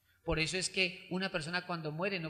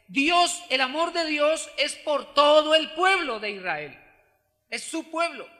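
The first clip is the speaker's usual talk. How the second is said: loud, raised preaching voice; full-force preaching; 7 semitones higher, 11 dB louder than usual. Acted. Preacher urging the congregation about Israel, loud and high. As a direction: drive home God's love for his people.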